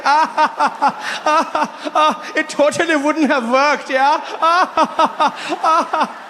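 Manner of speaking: hammed-up German-accented hysterics, pitched high; killing himself laughing. Friend mocking him on the ride home, hysterical laughter. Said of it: "he's killing himself".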